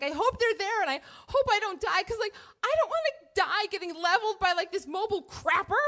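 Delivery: high-pitched fretting; comic dread. Retold or imitated. Rider dreading being flattened on the truck, high and anxious. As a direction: fret high with mock-desperate worry. Dreading death by porta potty.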